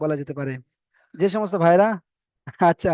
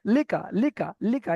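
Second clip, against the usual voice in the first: "লিকা" repeated short, with no stretch; quick short syllables; 3 dB quieter than usual. Shown as a demonstration. Teacher shows what is not stretched, "লিকা" short and quick. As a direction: say each li-ka of "লিকা" briskly, both vowels brief. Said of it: "এটাকে তাড়াতাড়ি বলতে হবে".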